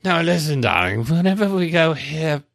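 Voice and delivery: a hint of tipsy posh drunk; lightly slurred gentleman drunk; drunken Dudley Moore. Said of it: "Whenever he played the drunken".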